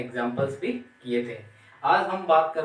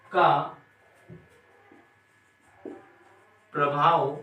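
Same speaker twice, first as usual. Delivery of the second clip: slow dictation. Shown as a demonstration. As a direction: dictate for students to copy. Dictates definition slowly with pauses. (रुक-रुक कर लिखवाना)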